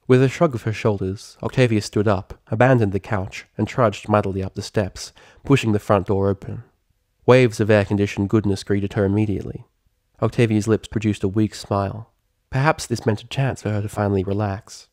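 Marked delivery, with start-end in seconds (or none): none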